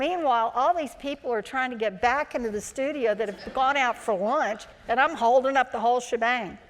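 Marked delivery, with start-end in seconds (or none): none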